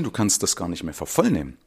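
ordinary talk, nothing marked

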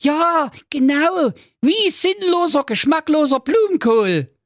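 high, loud cry of the prince; prince calling out. Acted. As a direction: shout it out in frustration. (hoher, lauter Ausruf des Prinzen)

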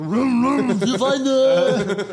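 high laughing yell; mock shout. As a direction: yell it from a passing car. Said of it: "Aus dem Sportwagen so".

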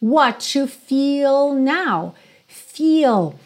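"Feel" with a long, drawn-out EE; long vowel in feel. Shown as a demonstration. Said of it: "saborear essa palavra". ("Feel" com a vogal longa, saboreada)

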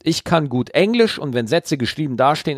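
ordinary talk

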